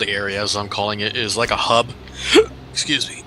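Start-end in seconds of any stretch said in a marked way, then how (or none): 2.1-2.7 startled gasp; a sudden high gasp; sharp high gasp. Real, not acted. Sudden gasp mid-sentence.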